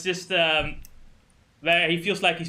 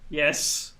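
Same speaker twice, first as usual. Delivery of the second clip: ordinary talk, nothing marked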